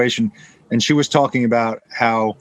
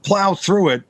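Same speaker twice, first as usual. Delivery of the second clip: higher, slow bossy order; shitty sales manager; 8 semitones higher, slower than usual. Retold or imitated. A bad sales manager ordering a nervous rep, slow bossy command. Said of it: "your guy said".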